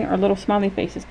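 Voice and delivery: ordinary delivery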